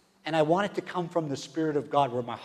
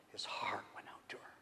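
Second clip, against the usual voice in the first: soft whispered scripture; hushed reading; 5 semitones lower, 17 dB quieter than usual. Acted. Preacher reading the verse to the church in a soft whisper. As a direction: draw them in with a hush.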